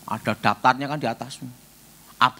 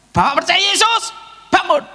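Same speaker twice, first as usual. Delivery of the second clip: high, loud command to rise; commanding prayer; 14 semitones higher, 6 dB louder than usual. Retold or imitated. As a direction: order the man up in faith. Commands the sick man.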